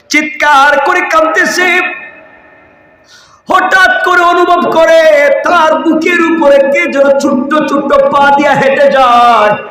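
high, ringing sermon cry; full-force preaching. Acted.

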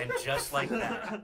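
slow, high and laughing; laughing dramatic delivery. Pretend. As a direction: drag it out dramatically while laughing.

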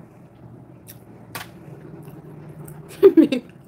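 sudden high cackle, loud and unvoiced; loud high laugh. Real, not acted. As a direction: laugh off the insult. Laughing at a troll.

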